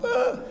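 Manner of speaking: put-on high child-like "Ah!" yelp; cub's squeal. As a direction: squeal like a scared little cub. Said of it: "little children's sounds for the bear".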